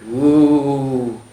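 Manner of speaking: ordinary delivery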